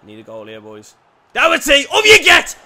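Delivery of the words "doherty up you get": big roaring shout at the screen; full-throated fan roar; urging shout. Real, not acted. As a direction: yell him up to win the header. Fan urging player at a header.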